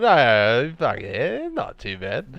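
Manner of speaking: mock Aussie, easing back in volume; lightly put-on Australian accent; poor Aussie accent. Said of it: "Poor Aussie accent".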